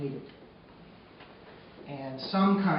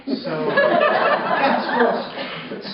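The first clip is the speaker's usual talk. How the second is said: laughing it out, higher and louder; 14 semitones higher, 10 dB louder, much slower than usual. Real, not acted. Boy laughing at his squirrel report topic, higher and louder.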